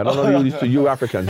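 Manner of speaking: normal talking voice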